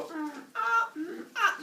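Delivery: high little stalling "mm, ah"; squeaky, halting sounds of indecision; mock hesitation noises. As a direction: imitate someone dithering for an answer. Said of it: "time to sit there and go".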